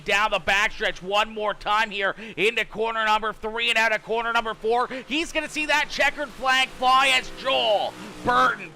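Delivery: brisk, rising race call building to the flag; race play-by-play. Acted. Streamer calling the heat race finish for viewers, energetic play-by-play. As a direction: call the finish like a live announcer.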